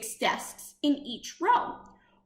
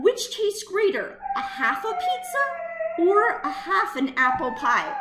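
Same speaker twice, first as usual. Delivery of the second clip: laughing; 6 semitones higher than usual. Acted. Boy overwhelmed by lunch math.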